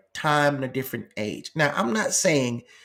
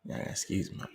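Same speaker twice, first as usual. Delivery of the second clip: soft whisper to someone nearby; whispered aside; 12 dB quieter than usual. Real, not acted. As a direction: whisper it off to the side.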